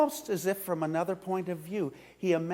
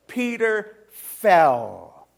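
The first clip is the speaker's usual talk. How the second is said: very slow, loud, weighted words; preacher drops Peter's fall slowly, heavily; heavy emphasis; 4 semitones higher, 7 dB louder, much slower than usual. A narrator's reading. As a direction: let each word fall like a stone. Climax of despair.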